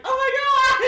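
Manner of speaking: high, loud squeal with a laugh; excited squeal. Acted. Mikhail hearing the engagement, excited squeal. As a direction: shriek with delight.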